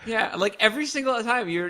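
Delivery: usual speaking voice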